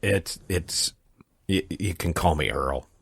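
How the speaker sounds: a deep grand put-on noble; mock lordly. Merle playing a new lord in a low mock-grand voice. Posh lord persona joke.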